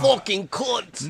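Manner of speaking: imitating a slow British accent curse. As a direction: copy the British accent swear, slowly. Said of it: "turned British".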